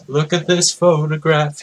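high put-on voice, thrilled; grandfather's excited squeal; excited cry. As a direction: cry it out in a different voice. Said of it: "he cried".

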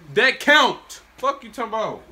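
loud, high protest at a bad call; angry at the game. Real, not acted. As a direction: argue angrily with the ref. Arguing with the game.

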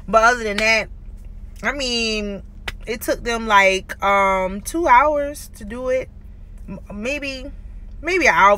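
fingers pulling lip aside; speaking around metal brackets; talking with new braces. Real, not acted. Seen 2: Braces visible throughout; she pulls lips to show brackets.

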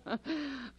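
a soft falling chuckle; soft laugh. Acted. Hostess still chuckling at the detective's retort, soft laugh.

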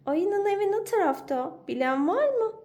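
friends asking. Acted. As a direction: call out asking the way. Friends ask the way.

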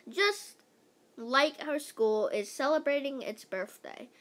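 ordinary delivery, nothing marked

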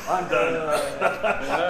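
breaking into laughter; laughing hard. Real, not acted. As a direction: laugh helplessly, high. Laughing after failed call.

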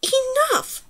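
very high-pitched cry to stop; exasperated command. A narrator's reading. Girl telling off her passengers, sharp high command. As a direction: order squabbling passengers to quit it.

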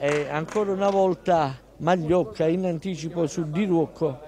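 ordinary talk

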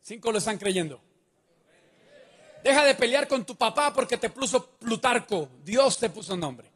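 ordinary delivery

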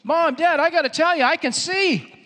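high, loud, breakneck excitement; excited. Acted. Healed man tells mom and dad, excited. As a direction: blurt out great news to your parents. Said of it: "He gets so excited".

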